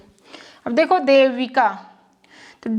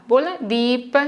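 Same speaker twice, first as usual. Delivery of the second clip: ordinary talk, nothing marked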